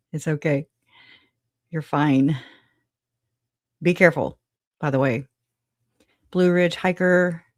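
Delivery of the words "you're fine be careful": usual speaking voice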